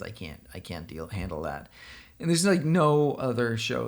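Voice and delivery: normal talking voice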